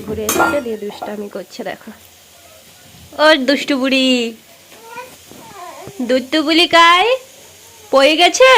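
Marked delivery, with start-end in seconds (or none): 3.2-8.5 calling out. Real, not acted. Mother calling her naughty little girl, loud and high.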